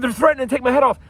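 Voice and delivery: high-pitched imitation of a panicky caller; hammed-up alarm, pitched high; mock alarmed complaint. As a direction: mimic an accuser crying threat. Imitating neighbor's alarmed phone complaint.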